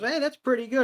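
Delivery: high, dazed and fried; dazed praise. Retold or imitated.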